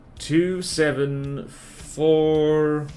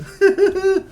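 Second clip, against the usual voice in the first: loud high laugh; high burst of laughter; 6 dB louder than usual. Real, not acted.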